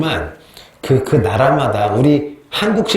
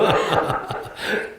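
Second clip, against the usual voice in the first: breathy laugh; 5 semitones higher, 6 dB quieter than usual. Real, not acted. Laughing at own story.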